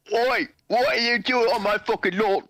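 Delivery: put-on slow, high, grumpy British geezer yelling at kids; cranky old British man accent, drawn out; pissed-off old British man. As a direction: shout at them off your lawn like an angry old Brit. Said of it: "pissed off old man Brit".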